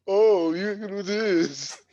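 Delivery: high, slow, sugary mock encouragement; mock encouraging voice. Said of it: "that same voice when they knock people".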